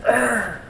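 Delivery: growled imitation of a choked dad; mock growl. Son retelling the noise his dad made when choked, a mock growl. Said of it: "I remember the noise he made".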